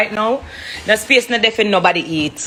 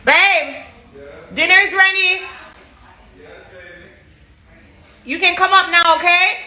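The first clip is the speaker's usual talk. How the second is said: yelling across the room; 8 semitones higher, slower than usual. Real, not acted. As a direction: shout up the stairs to dinner. Calling family up from basement.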